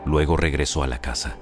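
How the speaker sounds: usual speaking voice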